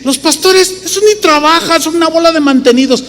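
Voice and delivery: high, quick sneering voice of a scoffer; mocking scornful critic. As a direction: parody the people who sneer at pastors.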